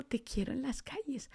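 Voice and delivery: very softly, a voice that persists; soft inner voice. Retold or imitated. God keeps calling her to the streets, soft and insistent. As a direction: imitate God's quiet, insistent call. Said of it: "sigue esa voz diciendo".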